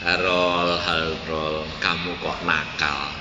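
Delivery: low, soft, gentle scolding; voice of Jesus. Retold or imitated. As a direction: speak as Jesus, low and tender. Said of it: "Tuhan Yesus bilang apa". (suara Yesus yang rendah dan lembut)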